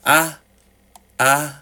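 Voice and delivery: halting repeated I, stuck on the word; a gentle, understated stutter. Acted. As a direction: stumble over the I as if searching. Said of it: "he stuttered".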